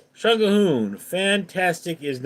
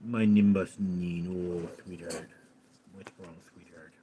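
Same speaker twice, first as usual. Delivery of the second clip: low, hushed cooing to a pet; murmured low, off to the side; soft pet talk; 8 semitones lower, 8 dB quieter than usual. Real, not acted. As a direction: soothe a pet very quietly. Turns aside to soothe a pet.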